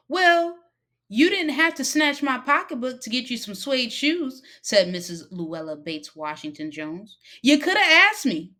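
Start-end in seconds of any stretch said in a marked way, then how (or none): 0.1-4.4 high, loud scolding woman; stern Mrs. Jones. Acted. 7.4-8.5 high, loud scolding woman; stern Mrs. Jones. Acted.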